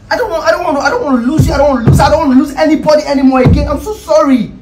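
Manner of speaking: high, urgent pleading; desperate apology. Acted. Desperate apology, fears losing her.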